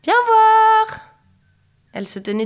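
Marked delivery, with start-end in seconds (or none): 0.0-1.0 very high, loud, drawn-out call. A narrator's reading. Marine shows mother her flying, loud high call. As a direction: sing out for attention. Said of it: "cria-t-elle".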